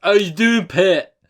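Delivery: loud, high Geordie attempt; hammed-up Newcastle greeting; mock Geordie accent. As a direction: belt out a badly faked Geordie. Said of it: "Is that how they speak".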